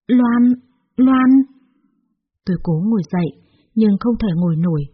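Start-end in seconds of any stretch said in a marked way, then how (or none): 0.1-1.3 high, loud cry of her name; shocked exclamation. A narrator's reading. Young man recognising his girlfriend's mother, shocked.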